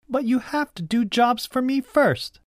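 put-on high-pitched bossy girl; high voice of a child in charge; bossy cousin. A narrator's reading. Bossy cousin sets her condition.

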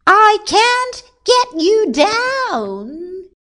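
high, loud, long-drawn call; helpless shout. A narrator's reading. Someone below calls up to stuck king, helpless shout. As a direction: shout it upward, stretching the words.

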